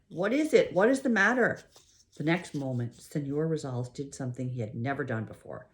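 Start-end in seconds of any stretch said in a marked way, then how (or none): 0.1-1.6 worried neighbor calling over; concerned calling out; calling again. A narrator's reading. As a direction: call across, a little higher. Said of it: "he called again".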